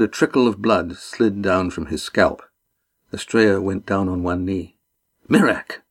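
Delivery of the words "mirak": a high startled cry of a name; sudden high exclamation; exclaimed. A narrator's reading. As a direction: recognize him with a jolt. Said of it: "he exclaimed".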